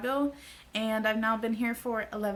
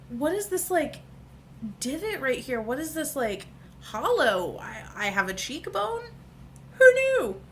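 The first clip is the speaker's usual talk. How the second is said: giddy amazement; 4 semitones higher, 4 dB louder than usual. Retold or imitated. Marvelling at her cheekbones.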